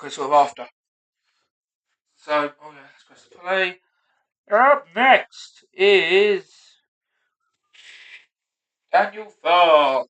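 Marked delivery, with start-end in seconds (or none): 4.5-10.0 drawn-out stage introduction; mock MC announcer. Comic rehearsing his own introduction, mock MC announcer. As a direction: stretch it out like an MC bringing on an act.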